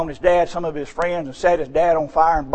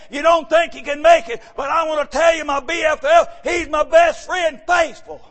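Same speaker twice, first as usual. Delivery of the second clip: preaching, building; 11 semitones higher than usual. Acted. Preacher building up to a shout for his brethren. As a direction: build the sermon up to a cry.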